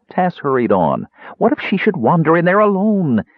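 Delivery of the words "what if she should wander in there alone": high, anxious plea; pleading. A narrator's reading. Tass begging Caramon to follow her, pleading. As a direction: beg him to think of her alone. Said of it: "he asked pleadingly".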